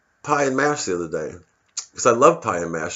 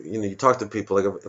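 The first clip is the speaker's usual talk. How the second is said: ordinary delivery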